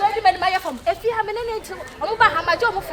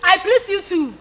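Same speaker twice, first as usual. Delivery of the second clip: normal talking voice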